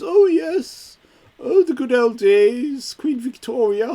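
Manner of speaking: high, loud, hammed-up mock Welsh accent. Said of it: "he talks like this".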